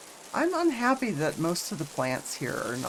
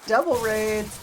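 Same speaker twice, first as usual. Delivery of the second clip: excited; 5 semitones higher, 5 dB louder than usual. Real, not acted. Excited raid announcement.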